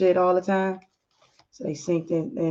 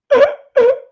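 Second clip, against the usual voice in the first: distraught wail; 10 dB louder than usual. Acted. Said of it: "His wife is calling him all distraught".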